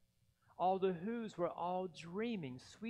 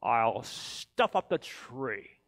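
gleeful grin; 5 semitones lower, 11 dB louder than usual. Acted. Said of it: "grinned the Grinch".

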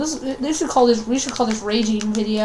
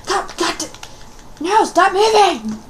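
frustrated yell; 6 semitones higher than usual. Real, not acted. Yelling at the game character who won't hold still, frustrated. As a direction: rage at the moving target. Raging at a moving target.

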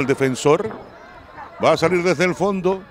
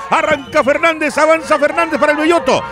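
higher, faster rising call; excited play-by-play; 6 semitones higher than usual. Real, not acted. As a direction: build the attack excitement. Attack commentary. (narración emocionada)

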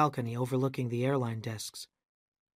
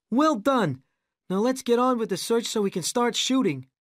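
higher, louder brisk praise; brisk director; 9 semitones higher, 4 dB louder than usual. A narrator's reading. Rick praising Annie and Arabella. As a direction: move everyone along.

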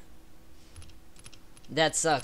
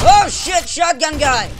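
high, loud alarmed yell; startled shout; 10 semitones higher, 10 dB louder, slower than usual. Real, not acted. Gamer warns teammate of shotgun guy, startled shout. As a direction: shout a sudden warning. Spots an enemy in game.

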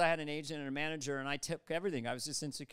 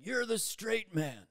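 slow, drawn-out declaration from a higher pitch; director's pronouncement; 5 semitones higher, slower than usual. Retold or imitated. Director casting him at the read-through, slow pronouncement. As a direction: hand down the casting verdict slowly. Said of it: "pointed at me and said".

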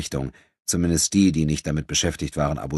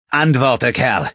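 loud, higher barked order; gruff command; 8 semitones higher, 8 dB louder, much slower than usual. Acted. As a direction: bark the order at him. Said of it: "sagte Enrico barsch". (barscher, lauter Befehl)

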